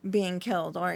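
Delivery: ordinary talk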